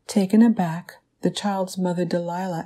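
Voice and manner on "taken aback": ordinary delivery, nothing marked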